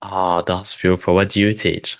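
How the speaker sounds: ordinary talk, nothing marked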